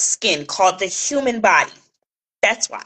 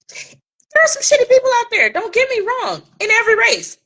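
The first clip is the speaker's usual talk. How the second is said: high aside; 13 semitones higher than usual. Real, not acted.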